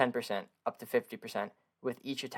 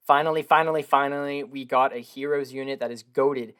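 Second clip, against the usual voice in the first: loud, raised, emphatic celebration; excited relief; 2 semitones higher, 7 dB louder than usual. Real, not acted. Player celebrating a long-awaited heroes unit, excited relief. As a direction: hammer out each repeat with real excitement.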